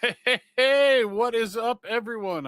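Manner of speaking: high, booming "hey, hey, hey"; loud hyped greeting. Real, not acted. Co-host greets everyone on the podcast in a loud, hyped shout. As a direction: burst in loud and high, then ease down.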